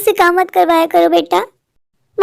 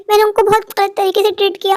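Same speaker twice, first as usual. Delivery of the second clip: usual speaking voice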